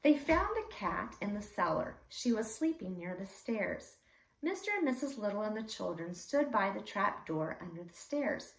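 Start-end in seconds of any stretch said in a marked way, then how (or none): none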